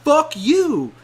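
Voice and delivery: a drawn-out, loud brush-off; angry retort. Retold or imitated. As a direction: spit it back slowly and loudly. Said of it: "I was just like".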